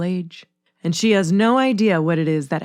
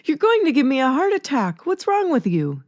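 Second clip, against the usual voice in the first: raised pitch, alarmed outburst; exclaiming; 8 semitones higher than usual. A narrator's reading. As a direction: blurt it out in shock, higher. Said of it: "she exclaims".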